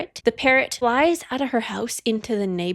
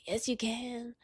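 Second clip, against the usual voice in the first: much softer, gently insisting; soft coaxing reply; 12 dB quieter than usual. Retold or imitated. Heroine gently coaxing the hero, soft and insistent. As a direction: coax him softly. Acted quote in a couple's argument.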